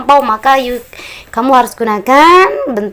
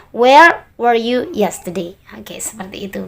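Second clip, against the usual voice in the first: whisper; slower than usual. Shown as a demonstration. Teacher suddenly whispers the answer.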